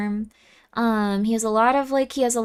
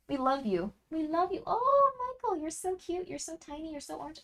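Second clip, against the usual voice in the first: cooing to cat; 4 semitones higher, 11 dB quieter than usual. Real, not acted. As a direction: murmur sweetly to the cat.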